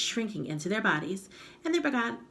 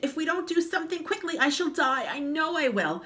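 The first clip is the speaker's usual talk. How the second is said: high, fast panicked voice; frantic; 9 semitones higher than usual. Acted. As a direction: gabble in fear. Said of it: "screamed Missus Twit".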